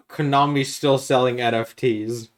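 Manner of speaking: usual speaking voice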